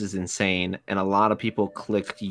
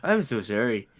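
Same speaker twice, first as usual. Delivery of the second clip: hammed-up Canadian apology, "sorry" in the Canadian way; jokey put-on Canadian accent; 5 semitones higher, 4 dB louder than usual. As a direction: play an overly polite Canadian saying sorry. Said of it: "real Canadian accent".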